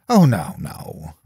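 slow, drawn-out sarcastic refusal; dry mock protest. A narrator's reading. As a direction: feign total lack of interest. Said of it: "I replied sarcastically".

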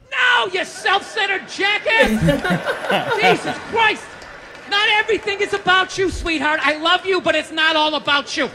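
loud, high-pitched scolding; exasperated mock outburst. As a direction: blow up at a self-centered spouse. Acted outburst at wife.

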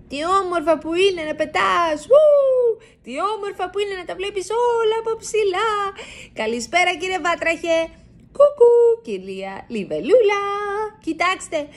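high, delighted calls from the sky; joyful shouting. A narrator's reading. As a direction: mice greeting the pond folk as they fly. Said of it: "φωνάζουν χαρούμενα".

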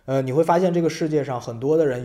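ordinary talk, nothing marked

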